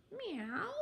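high, soft little meow; cat's meow. Acted. The cat answers Eugenia, high soft meow.

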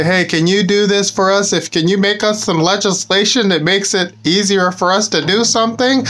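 high, wheedling lobbyist asking a favour; pleading lobbyist. Retold or imitated. Lobbyist asking public servant for legislation, high pleading voice. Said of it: "they say".

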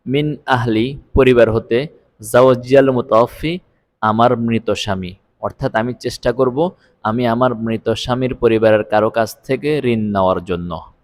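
ordinary talk

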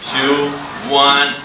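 usual speaking voice

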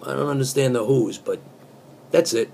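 ordinary talk, nothing marked

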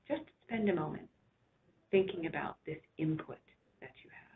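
unhurried relaxation voice with long pauses; guided meditation. Real, not acted. Doctor guiding listeners to rest back and relax, soothing guided imagery.

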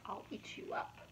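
breathy whispered menace; whispered threat. A narrator's reading. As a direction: whisper it like a little monster. Max's threat in story.

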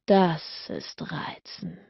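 a low, slow growling tease; a lightly growled slow tease; slow teasing growl, lightly voiced. A narrator's reading. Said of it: "Er lächelte und knurrte".

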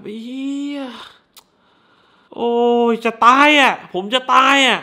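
high, loud groan of disgust; disgusted viewer. Retold or imitated. He acts his own reaction watching, high and wailing. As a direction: wail that you can't take it. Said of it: "แล้วแบบ".

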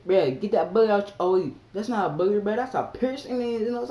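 high, loud excited rant; shouting at the screen, half crying; worked up. Retold or imitated. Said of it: "I said".

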